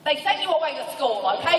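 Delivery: mock angry outburst; pissed-off boss, retold. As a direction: imitate a boss at the end of his patience. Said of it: "he's, like, so pissed at me".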